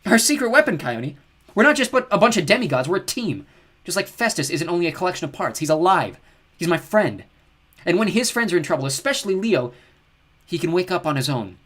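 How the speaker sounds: loud, high impassioned speech; crying out. Acted. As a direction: pour your heart into it. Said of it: "Piper cried".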